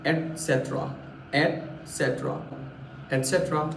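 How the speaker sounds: एटसेटरा said et-set-ra, t before s; एटसेटरा, not एक्सेट्रा, et-set-ra; correct pronunciation. Shown as a demonstration. Teacher giving the right एटसेटरा, et-set-ra. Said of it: "सही pronounce किया जाता है".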